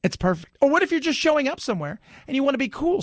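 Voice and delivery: normal talking voice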